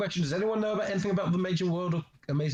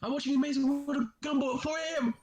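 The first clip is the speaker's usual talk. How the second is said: mocking kid; 10 semitones higher, faster than usual. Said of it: "quoting the story's kid".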